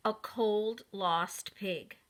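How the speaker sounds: ordinary delivery, nothing marked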